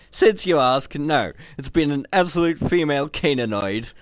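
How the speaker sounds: loud, sour complaint; grumbling. A narrator's reading. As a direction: grumble it sourly. Hans complaining.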